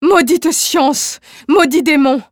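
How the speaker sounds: damn the science that steals him; despairing curse. Acted. Wife curses his science in despair.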